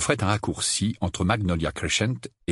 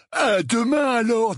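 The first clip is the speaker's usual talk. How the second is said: high, slow loutish goodbye; Dudley, gang leader; 4 dB louder, slower than usual. Acted. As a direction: call it out lazily, pitched high. (au revoir traînant, voix aiguë)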